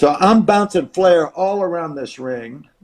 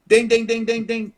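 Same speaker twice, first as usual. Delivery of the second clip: high, rapid bell dings; imitated ring bell; 7 semitones higher than usual.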